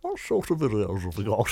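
imitating Justin. Host ends the round of Dealey Whispers, mock impression. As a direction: drop deep to copy a mate's voice. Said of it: "what your real voice sounds like".

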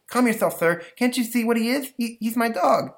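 put-on high, pleading little boy; calm them down like a small boy. Stanley calms them down.

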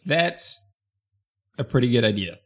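halting, with a long pause mid-sentence; teasingly overdone dramatic pause; William Shatner. Pretend. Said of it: "stop talking like William Shatner".

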